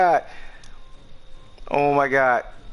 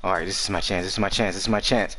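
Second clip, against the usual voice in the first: hushed, tense waiting; whispering while hiding; 7 semitones lower, 5 dB quieter, faster than usual. Real, not acted. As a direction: keep it low and tense.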